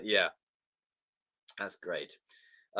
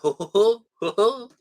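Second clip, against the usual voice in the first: high hoots climbing upward; rising "oh ho ho"; 10 semitones higher, 5 dB louder than usual. Retold or imitated. Fan reliving a film line, hooting high. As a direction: hoot it in mounting delight. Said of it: "I was like".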